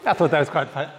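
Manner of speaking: ordinary talk, nothing marked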